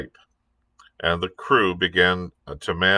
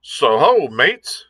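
raised, hearty sea-cook hail; pirate hailing mates; 6 semitones higher, 3 dB louder, slower than usual. A narrator's reading. Long John greets his mates with a hearty call.

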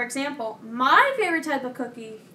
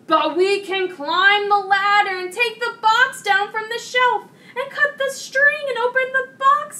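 high, loud toad, climbing as it goes; objecting toad; 8 semitones higher, 6 dB louder than usual. A narrator's reading. Toad countering Frog's high-shelf plan, objecting insistently. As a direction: pile up the objection, rising higher and louder. Toad's escalating objection, read aloud.

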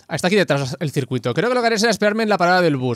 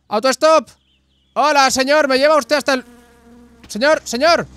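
higher, louder pleading call; 8 semitones higher, 4 dB louder than usual. Pretend. Hitchhiker calling to passing driver, pleading shout.

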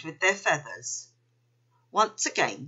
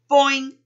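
high, loud springy boing; cartoon spring noise; boing sound effect; 7 semitones higher, 7 dB louder than usual. A narrator's reading. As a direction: bounce the word like a spring.

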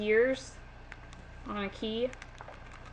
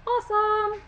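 high bright exclamation; thrilled cheer; excited; 12 semitones higher, 6 dB louder than usual. Real, not acted. Thrilled at nail stickers.

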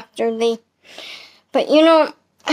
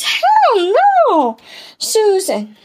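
high mock cry of disbelief; Mister Wilson's outburst; 8 semitones higher, much slower than usual. Mister Wilson scoffing at Susan, much higher mock cry. He goes.

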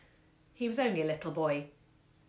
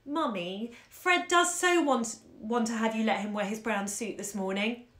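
put-on shy childish begging; timid pleading; 2 semitones higher than usual. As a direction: plead timidly like a small girl. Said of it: "who said pleadingly, timidly".

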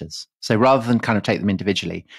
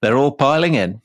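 put-on Yorkshire accent; 3 semitones higher than usual. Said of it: "in that accent".

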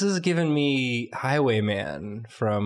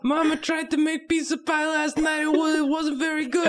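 put-on high kid voice, Brooklyn accent; squeaky little girl, Brooklyn twang; Brooklyn Anya. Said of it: "Anya from Brooklyn".

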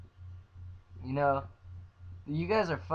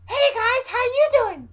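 very high, loud squeaky greeting; mocking high-pitched voice; 10 dB louder, slower than usual. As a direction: squeal the greeting to mock video makers. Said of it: "not a fucking high-pitched voice".

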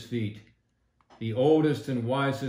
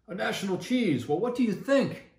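high, bright eager asking; eager question; 9 semitones higher, 3 dB louder than usual. A narrator's reading. Squeaker phoning the leader, eagerly high-pitched. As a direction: pitch it up, excited to share the idea. Longworth asks Russell.

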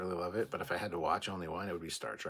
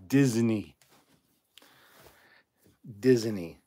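disgusted; 8 dB louder, slower than usual. Real, not acted. Repeating Disney in disgust.

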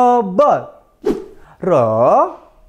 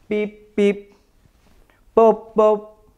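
robot voice; slower than usual. Shown as a demonstration. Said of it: "Bergerak seperti robot".

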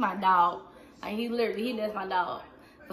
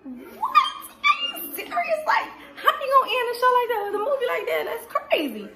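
very high, squeaky disbelief; high-pitched mock outrage; shocked outburst; 13 semitones higher, 5 dB louder than usual. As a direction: squeal it in loud, hammed-up shock. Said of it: "if I'm shocked, I'm like".

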